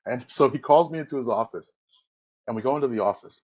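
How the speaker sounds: ordinary talk, nothing marked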